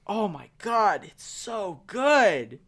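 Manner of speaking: drawn-out, swooning praise; awed gushing. Retold or imitated. Fan reliving the unspoiled episode for a co-host, gushing. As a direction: savour it, stretching the last words long.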